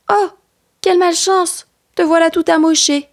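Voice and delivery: high-pitched storybook alarm; panicked exclamation. Acted. Boy runs to his fallen friend Ours, acted panic. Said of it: "tout paniqué".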